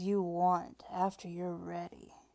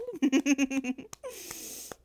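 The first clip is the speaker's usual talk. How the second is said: higher, louder rippling giggle; teasing giggle; 5 semitones higher, 5 dB louder than usual. Acted. Teasing an eager partner, high giggle. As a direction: giggle in a long run.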